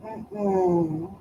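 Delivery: usual speaking voice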